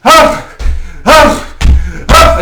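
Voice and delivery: loud high huffing snorts; aggressive huffs. Acted. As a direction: puff up and huff like a bull.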